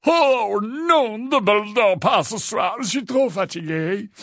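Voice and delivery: feigned yawning, high fluty voice; high, languid, put-on tiredness; Phineas. A narrator's reading. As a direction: whine it through a fake yawn. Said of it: "en faisant semblant de bâiller longuement".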